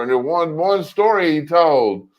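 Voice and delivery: normal talking voice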